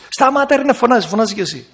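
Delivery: high, shrill-sounding mock yell; mock shouting wife. Wife repeats how she shouted at her husband, high mock yell. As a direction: bark it high like a fed-up wife. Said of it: "Μα τι του είπες".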